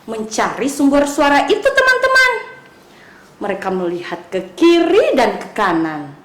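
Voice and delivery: plain reading voice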